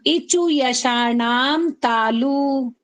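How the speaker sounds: very slow, drawn-out sutra chant; slow recitation. Shown as a demonstration. Teacher chants the sutra slowly for students to repeat. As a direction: stretch every syllable for the class. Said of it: "अब बोलना".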